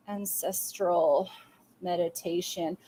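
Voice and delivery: "Ancestral meditation" said very slowly and softly, each syllable drawn out; quiet, careful dictation of "Ancestral meditation". Shown as a demonstration. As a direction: spell it out slowly as if writing it down. Spelling out a note.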